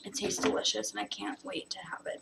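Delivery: hushed whisper, almost no voice. Real, not acted. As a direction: whisper it close to the mic. Whispering tasting comment to camera.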